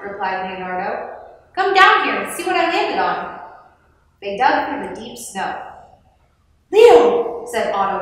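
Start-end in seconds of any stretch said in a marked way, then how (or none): 1.5-3.4 higher, louder storybook call; raised voice, calling someone to come. A narrator's reading. Calling up from the snow. 6.7-7.4 high, loud astonished exclamation; loud, high-pitched astonishment. A narrator's reading.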